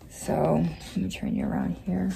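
soft whispered aside; real whisper. Real, not acted. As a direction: whisper as you turn the camera. Whisper while moving camera.

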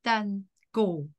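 slow, separated words; careful word-by-word teacher's model; slow modelling. Shown as a demonstration. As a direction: space out each word for a learner. Teacher models phrase slowly for repeating.

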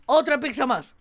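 ordinary talk, nothing marked

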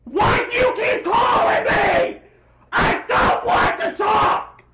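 loud, slow, furious yelling at a phone caller; angry shout. Acted. Fed up with calls, yelling at the caller, angry shout.

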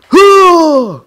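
high, drawn-out falling "huh"; loud effort cry. Acted. Player throwing a sword in game, loud effort cry. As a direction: heave it out with a yell.

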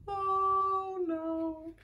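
high, thin little moan; dismayed possum. A narrator's reading. Possum sprayed again.

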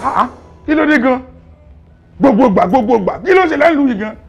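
very loud, high, outraged tirade; raving rant. Acted. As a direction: rage at full volume in a police station. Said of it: "Please stop raving and ranting".

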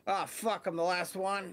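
last chopper out of Saigon. Acted. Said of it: "this is my impression of the last chopper out of Saigon".

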